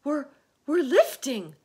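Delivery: high, loud, stammered discovery; excited high-pitched cry; shouting. A narrator's reading. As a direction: shout it in astonishment. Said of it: "somebody shouted".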